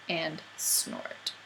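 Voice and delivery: whispered, trailing off; faded whisper. A narrator's reading. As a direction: let it fade to a breath. Snoring fades.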